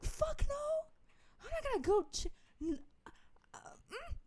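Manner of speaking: high squealed refusal, breaking off into stammers; scared refusal. Retold or imitated. Teller replying to dad's order to check, scared squeal. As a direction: balk in a retold high-pitched panic.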